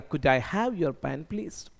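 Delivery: Could I have your pen please, slow and clear; slow model sentence. Shown as a demonstration. Teacher models a polite request to the class, slow. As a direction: spell the request out slowly for learners. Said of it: "तो बनेगा".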